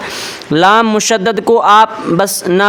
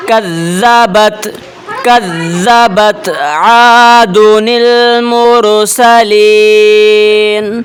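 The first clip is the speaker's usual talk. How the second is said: slow, louder melodic tajweed recitation; Quran recitation; 4 dB louder, much slower than usual. Shown as a demonstration. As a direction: stretch each vowel like a reciter. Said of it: "اس کو تلاوت کرتے ہیں". (آہستہ، بلند آواز میں تلاوت)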